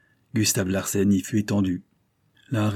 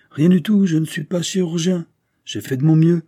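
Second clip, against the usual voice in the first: much higher and louder, brushing it off; emphatic refusal; 8 semitones higher, 6 dB louder than usual. Acted. Surgeon refusing the captain's payment, higher and louder. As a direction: wave away the money, animated.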